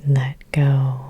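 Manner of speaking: ordinary talk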